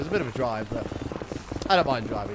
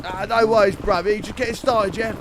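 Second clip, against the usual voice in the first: higher, chirpy London geezer voice; London bruv accent; 6 semitones higher, 4 dB louder than usual. Retold or imitated. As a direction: play the friendly London lad saying bruv. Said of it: "there was a lot of bruving".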